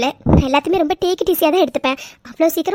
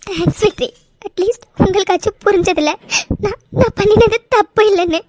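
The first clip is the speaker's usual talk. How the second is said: tearful, with sobs between phrases; crying. Acted. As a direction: pour out your hurt through sobs. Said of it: "please, நீங்க அழாதீங்க".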